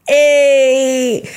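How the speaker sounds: loud, high, drawn-out hey; excited greeting. Acted. Introverted reader greeting someone who knows their book, excited. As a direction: burst out with a long delighted call.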